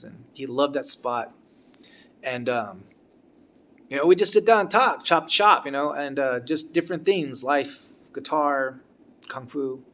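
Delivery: ordinary delivery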